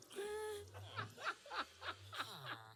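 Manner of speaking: high-pitched, drawn-out laughing fit; real helpless laugh. Real, not acted. Storyteller cracking up at the plate of shrimp, high laugh. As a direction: crack up in high, soft bursts.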